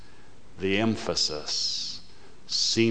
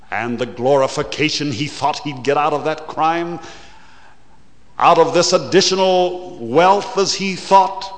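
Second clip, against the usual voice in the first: loud, higher and fast preacher's cry; preaching at full force; 7 semitones higher, 8 dB louder, faster than usual. Acted. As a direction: hurl it at the congregation. Preacher builds again.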